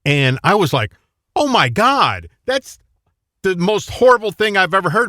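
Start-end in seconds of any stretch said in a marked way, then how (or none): 1.4-5.1 high-pitched shocked outcry; scandalized, incredulous retelling; mock horror. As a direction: recount being appalled, pitched way up. Acts out own horrified reaction.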